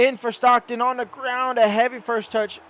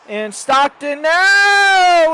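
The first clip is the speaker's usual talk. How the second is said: drawn-out, much higher and louder roar of a goal; goal call; 8 semitones higher, 9 dB louder, much slower than usual. Real, not acted. Commentator roars as his striker finally scores, long excited goal call. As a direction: stretch the name out in a big goal shout.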